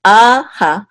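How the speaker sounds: a long and loud, ha short and lighter; emphasis on a. Shown as a demonstration. Teacher contrasting two syllables, a stressed over ha in "a ha". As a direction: punch and hold a, let ha drop. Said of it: "this a will be emphasized more than this ha".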